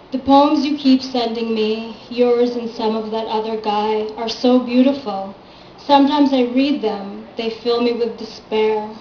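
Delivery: recital with loud, lifted line openings; measured verse, each phrase falling away; poem reading. Acted. Daughter reads her dear father's poem to an audience, measured recital.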